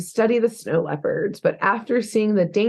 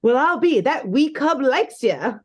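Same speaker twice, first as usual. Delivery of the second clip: a hammed-up, higher Scottish brogue; bad Scottish accent; 6 semitones higher than usual. A storyteller tries a Scottish accent for the Yeti. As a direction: attempt a Scottish accent, pitched up. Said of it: "Okay, an accent".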